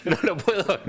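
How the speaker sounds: words broken up by giggles; laughing apology. Real, not acted. Host apologising to colleagues over a spelling slip, laughing. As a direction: apologise through real laughter.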